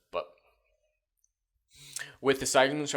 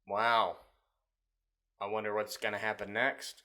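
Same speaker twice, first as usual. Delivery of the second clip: unimpressed wondering; 2 semitones lower than usual. Acted. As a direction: feign interest with no energy at all. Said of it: "No, it's like".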